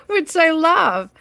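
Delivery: said through a high laugh. Real, not acted. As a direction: laugh while you say it. Laughing at moving house card.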